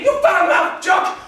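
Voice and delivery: slow, high, very loud; outraged name-calling; shouted insult. Acted. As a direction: bellow it back word by word.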